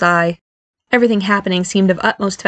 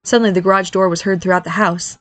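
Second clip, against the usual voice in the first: usual speaking voice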